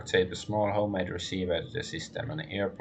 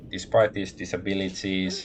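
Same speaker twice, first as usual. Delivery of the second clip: ordinary talk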